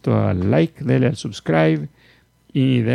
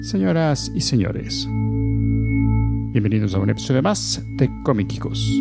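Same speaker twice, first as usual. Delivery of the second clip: ringmaster. Acted. Podcast host opening announcement.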